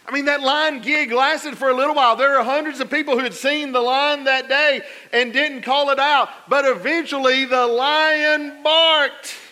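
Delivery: loud, high preaching build; full-force preaching. Acted. Pastor building to the barking lion, loud preaching.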